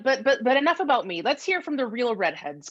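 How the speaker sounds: normal talking voice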